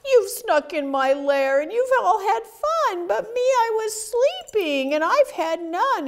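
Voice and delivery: high-pitched storybook moaning; whimpering, wailing bear. A narrator's reading. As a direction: wail like a bear left out of the fun. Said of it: "he whimpers and moans and he wails".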